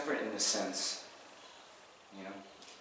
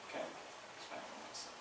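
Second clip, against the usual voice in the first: whispered; 16 dB quieter than usual. Shown as a demonstration. Teacher whispering the line again to the class.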